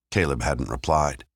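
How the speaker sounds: ordinary delivery, nothing marked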